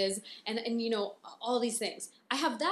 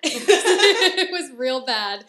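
laughing; 2 semitones higher, 13 dB louder, much slower than usual. Real, not acted. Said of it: "It was bad".

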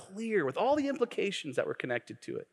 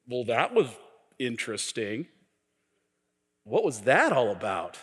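sneering enemy; 4 semitones lower than usual. Acted. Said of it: "and the enemy said".

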